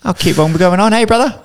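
very high mock New Zealand accent; cheery kiwi bloke impression; broad kiwi accent. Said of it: "They listen to six sixty".

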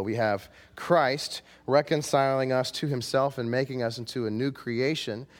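usual speaking voice

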